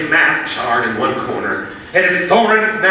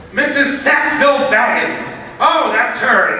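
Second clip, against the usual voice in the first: normal talking voice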